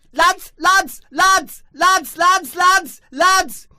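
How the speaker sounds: high, loud repeated calling; bursting to tell the lads; frantic high shouting of lads; frantic excited call. Acted.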